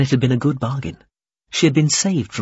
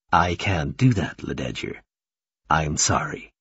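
regretful; 6 semitones lower than usual. A narrator's reading. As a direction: refuse gently, with regret. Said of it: "Himerance sounded regretful".

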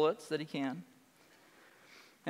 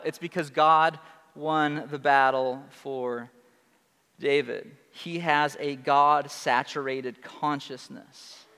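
ordinary talk, nothing marked

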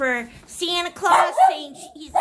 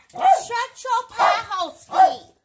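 mock loud scolding; 7 semitones higher, 8 dB louder than usual.

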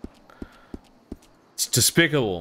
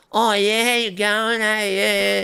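higher, over-inflected Australian twang; mock exaggerated Aussie; 8 semitones higher, slower than usual. Streamer mocking exaggerated Australian speech, hammed-up. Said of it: "it's not like I'm going".

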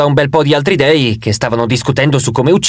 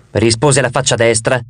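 ordinary delivery, nothing marked

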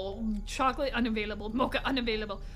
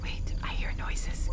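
hushed, nervous whisper; frightened breathy hush; scared whisper; 3 dB quieter than usual. Real, not acted. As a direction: whisper so nothing hears you. Whispering in horror game.

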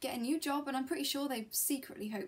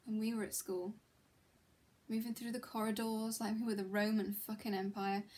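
ordinary talk

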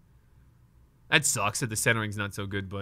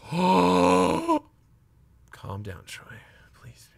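panicked gasp; 8 semitones higher, 5 dB louder, much slower than usual. Real, not acted. Collector panicking at stuck cards, gasping at himself. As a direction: jolt of panic, then beg yourself to settle.

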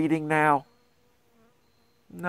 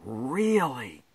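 touched surprise; 5 dB quieter than usual. Acted. Reader flattered.

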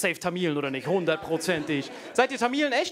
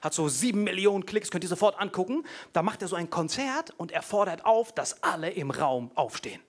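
usual speaking voice

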